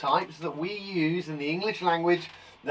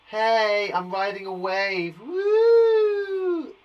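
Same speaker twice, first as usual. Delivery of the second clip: playful surfer cheer; 4 semitones higher, 4 dB louder, much slower than usual. Pretend. Acting a surfer for kids.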